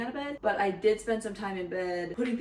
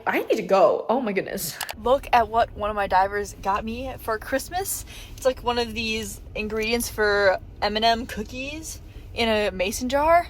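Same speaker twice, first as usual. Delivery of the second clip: ordinary delivery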